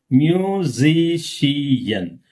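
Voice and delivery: "Musician" very slow, mu-si-ci-an; syllable by syllable. Shown as a demonstration. Teacher counting syllables for class, slow split word. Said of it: "How many syllables are there?".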